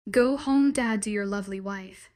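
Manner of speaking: ordinary delivery, nothing marked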